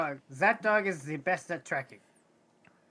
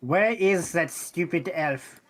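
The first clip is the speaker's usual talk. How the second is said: slow annoyed; much slower than usual. Acted.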